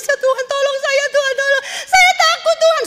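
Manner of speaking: high, pleading cry for help; frightened crying plea. Retold or imitated. Lucy in the dream, crying out to God in fear. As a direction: beg God for help, scared and weeping. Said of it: "saya ketakutan, saya menangis".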